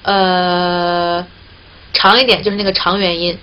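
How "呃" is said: long relaxed schwa vowel, mouth slightly open; schwa demo. Shown as a demonstration. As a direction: hold a long neutral 呃. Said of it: "就是这个呃的这个音就对了".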